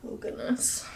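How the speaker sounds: breathy winded sigh; out of breath. Real, not acted. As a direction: exhale the words, spent. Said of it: "the girl's winded".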